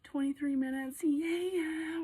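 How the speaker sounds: loud, drawn-out singsong delight; celebratory cheer. Real, not acted. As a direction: cheer the news loudly, coming down in pitch. Celebrating the time left.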